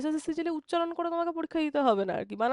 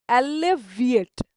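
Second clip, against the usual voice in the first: "Alleviate" slowly and louder; teacher's loud, slowed-down "alleviate"; slow, emphatic word; 5 dB louder, slower than usual. Shown as a demonstration. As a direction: draw out "alleviate" syllable by syllable, firmly. Repeats new word for learners.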